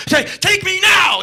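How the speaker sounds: high, slow, loud cry; mock-swooning yell; swooning shout. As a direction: scream it like a smitten fan. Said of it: "Scandinavian women just like".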